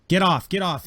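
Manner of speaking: high, loud shouted command; screamed order. Acted. Imperial soldiers screaming at people at the consoles, shouted order. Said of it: "they're screaming at people at the consoles".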